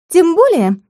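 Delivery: high, perky retort; cheeky, spirited declaration; pert, lively. A narrator's reading. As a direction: pipe up boldly in a high voice. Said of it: "бойко заявила помощница".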